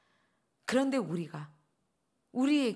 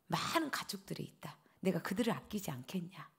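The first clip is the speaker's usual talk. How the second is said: soft whisper; 3 semitones lower, 9 dB quieter than usual. Retold or imitated. God's gentle closing question.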